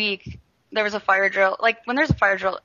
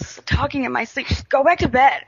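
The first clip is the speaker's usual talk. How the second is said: indignant denial; 5 semitones higher, 3 dB louder than usual. Retold or imitated. Friend's sleepy denial retold.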